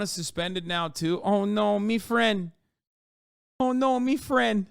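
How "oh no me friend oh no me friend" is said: lightly mocking, higher-pitched lament; mock a weirdo's babyish online wailing; put-on pitying whine, pitched up a little; mock baby talk. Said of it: "a guy like this talks like that".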